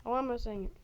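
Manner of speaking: usual speaking voice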